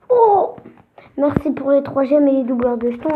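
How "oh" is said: sudden high oh; surprised oh. Real, not acted. Gamer reacting to a reward, surprised. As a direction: gasp it out in surprise.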